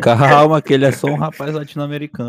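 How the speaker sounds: loud, fast and playful. Pretend. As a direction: belt it out jokingly. Joking song reference, loud.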